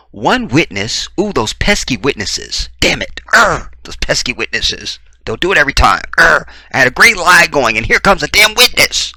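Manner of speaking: high, loud frustrated outburst; mock exasperation. Liar cursing those pesky witnesses, mock exasperation.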